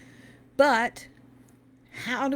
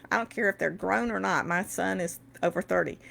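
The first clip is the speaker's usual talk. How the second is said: ordinary talk, nothing marked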